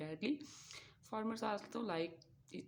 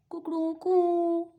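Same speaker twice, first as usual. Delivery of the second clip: slow, much higher, much louder cock-a-doodle crow; rooster crow; 11 semitones higher, 13 dB louder, slower than usual. Pretend. Host crowing like a rooster for the kids, loud and high. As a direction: belt it out like a rooster at dawn.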